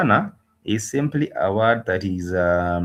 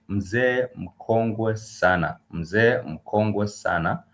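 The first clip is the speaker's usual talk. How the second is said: "mzee mkongwe sana" drawn out slowly, syllable by syllable; teacher slowly models "mzee mkongwe sana" for students, slowly; slow model phrase; much slower than usual. Shown as a demonstration. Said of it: "and that would be".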